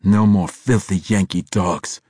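loud, contemptuous growl. A narrator's reading. Bandar exulting over the Yankees' downfall, growling. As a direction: growl it like an insult. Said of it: "Bandar growled".

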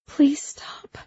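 high, whispery begging; whispered plea. A narrator's reading. Principal's wife covering her eyes, whispered plea. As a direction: beg weakly, voice thinning to a whisper. Whispering woman pleads again.

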